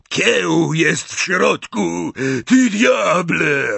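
high, loud, drawn-out roar. A narrator's reading. Hagrid roaring at the man who burned his hut. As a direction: roar it in outrage. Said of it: "ryknął Hagrid".

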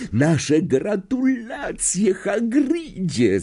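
very high drawn-out squeal; Hermiona. A narrator's reading. Girl congratulates the new teacher, high acted squeal. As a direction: squeal it out, stretching the words. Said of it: "zapiszczała Hermiona".

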